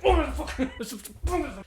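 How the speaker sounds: high, drawn-out mock outburst; imitated freak-out. Imitating the woman's pump fake scare, high mock outburst. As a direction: put on her high-pitched freak-out. Acting her sudden freak-out.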